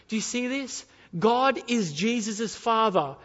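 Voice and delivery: loud, impassioned pulpit delivery; forceful preaching. Real, not acted. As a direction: drive the point home to the congregation.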